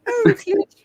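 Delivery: hammed-up lovesick cry; mock swoon. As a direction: gush it high, as a pretend admirer. Game master continues the mock swoon.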